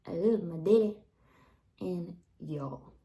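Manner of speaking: put-on a kid's Southern twang, first one loud; Southern child words. Recalling words parents tried removing, Southern accent. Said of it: "for my parents to rid me of".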